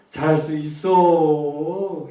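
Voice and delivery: low, very slow, Chungcheong-like long ending; Chungcheong-style drawl. Shown as a demonstration. Coach offering comfort, Chungcheong drawl. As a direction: draw it out soothingly, Chungcheong style. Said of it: "이런 것처럼".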